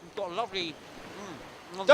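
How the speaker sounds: talking through a mouthful of pie; mouth full. Real, not acted. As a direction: chew and speak at once. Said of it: "Don't speak with your mouth full".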